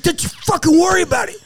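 fast, high-pitched mock anger; mad. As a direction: imitate a mad friend brushing off the question. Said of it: "starts getting really fucking mad".

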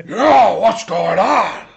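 loud, brash Pacino-style "Hey!" bursting out of a tiny cute dog; Al Pacino shout. Acted. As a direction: bark it like Pacino, sudden and loud. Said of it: "it's fucking Pacino".

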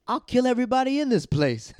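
mock menacing yell, high and loud; retold threat. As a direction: imitate a furious heckler, about to laugh. Heckler's threat in retold fight.

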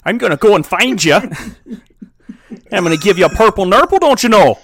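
blustering, exaggerated Canadian accent; mock Canadian accent. Host mock-threatening co-host, blustering Canadian. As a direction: make silly threats in hammy Canadian. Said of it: "this is a pretty good Canadian accent".

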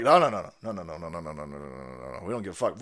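low, mocking chorus of no's fading away; sneering impression of a crowd saying no; mocking refusal. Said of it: "the Muslims are like".

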